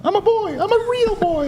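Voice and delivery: put-on high squeaky puppet boy; Pinocchio voice.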